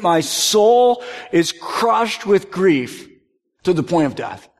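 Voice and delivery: slow, stressed "soul" and "crushed"; emphatic. Shown as a demonstration. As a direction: lean hard on "soul". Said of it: "Do you hear that statement?".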